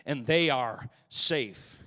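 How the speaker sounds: rising, loud, fast gospel preaching; whooping preacher building energy. Acted. Preacher building to full force.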